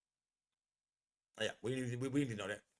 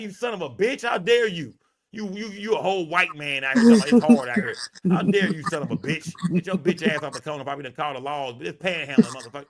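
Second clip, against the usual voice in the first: mock rant; 3 semitones higher, 8 dB louder than usual.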